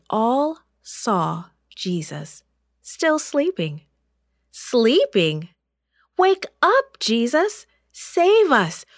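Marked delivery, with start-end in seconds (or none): 4.5-8.8 high, loud frantic plea; panicked yelling. A narrator's reading. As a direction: yell for help as the boat sinks. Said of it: "they yelled".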